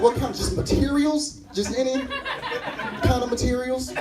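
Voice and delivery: baffled by the answer; incredulous question. Retold or imitated. Comic questioning Willie about his couch, high and disbelieving.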